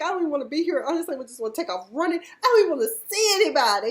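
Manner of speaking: imitating Jeff crying; mock crying. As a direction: whine like you're about to cry. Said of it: "He was like".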